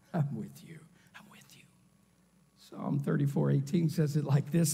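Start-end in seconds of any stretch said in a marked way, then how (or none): 0.1-1.6 sudden drop to a low whisper; whispered promise. Acted. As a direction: reassure in a barely audible hush.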